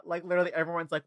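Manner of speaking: ordinary talk, nothing marked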